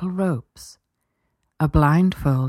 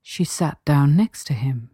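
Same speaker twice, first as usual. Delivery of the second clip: ordinary delivery, nothing marked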